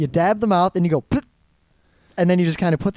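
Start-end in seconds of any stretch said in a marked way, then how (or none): 1.0-1.2 small puffed spitting noise; demonstrated spit. Shown as a demonstration. Showing friends the napkin technique, demonstrated spit. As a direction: mimic a discreet spit into a napkin.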